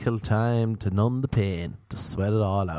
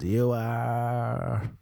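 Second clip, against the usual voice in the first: mock wail; 3 semitones higher than usual. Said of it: "I just wailed".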